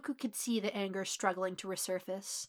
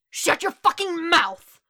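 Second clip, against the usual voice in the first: loud, drawn-out high shout; enraged bellow; furious roar; 9 semitones higher, 13 dB louder, slower than usual. A narrator's reading. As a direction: roar it right in their face. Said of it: "Bakugo roared in his face".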